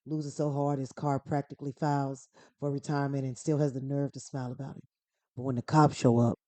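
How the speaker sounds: best attempt at a tough-guy movie voice; Dominic Toretto. Podcaster reading a plot summary as street racer, tough-guy impression. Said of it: "I'm gonna try my best".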